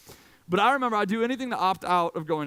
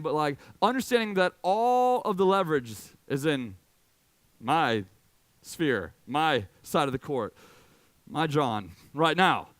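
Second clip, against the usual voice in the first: ordinary talk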